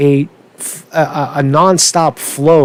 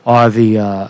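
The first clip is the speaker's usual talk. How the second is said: usual speaking voice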